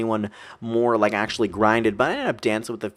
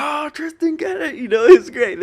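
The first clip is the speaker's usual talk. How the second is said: much higher, hyped cheer of the crowd; cheering friends; 7 dB louder than usual. Retold or imitated. Friends cheering Tristan on, high hyped mock cheer. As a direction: squeal it like friends egging you on. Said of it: "people are like".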